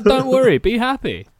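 easygoing landlord voice; chilled-out. Retold or imitated. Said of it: "he's much chiller than that".

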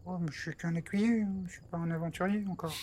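Trépide's voice. Acted. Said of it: "en faisant cette voix".

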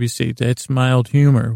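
ordinary delivery

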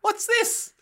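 a hammed-up high curious squeak; mock wonder. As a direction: squeal it with delighted surprise. Man tastes burger in ad.

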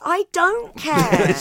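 high, loud, slow and drawn-out I don't care; mock cat voice. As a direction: play the Cat sneering without malice. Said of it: "And the cat goes".

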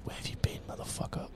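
hushed whispered jab; soft conspiratorial whisper to a co-host. Acted. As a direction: whisper it like a secret insult. Mock aside at missing hero.

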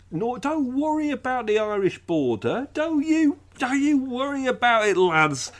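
high-pitched, hammed-up "don't you worry" voice; mock David Davis reassurance. Podcaster mimicking minister on Irish border, high mock reassurance. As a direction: breezily wave the worry away, pitched right up. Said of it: "David Davis said that the".

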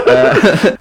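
bursts out laughing; high giggling laugh. Real, not acted. Laughs at own story.

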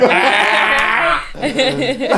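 bursts out laughing; big real laugh; loud laugh. Real, not acted. Laughing at "man them.

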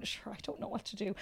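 whispered, lost and worn out; helpless whisper. Retold or imitated. Mother at night with the baby, whispering helplessly. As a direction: breathe it out in quiet despair.

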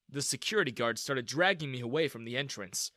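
usual speaking voice

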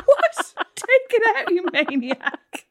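high, laughing outburst; laughing, shocked at the text; laughing disbelief. Retold or imitated. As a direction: squeal it through the laughter. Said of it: "I was like".

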